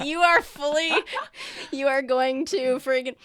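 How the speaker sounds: loud, high mock outburst at the dice; excited outburst. Game master reacting to a natural one, loud excited outburst. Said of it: "I was like".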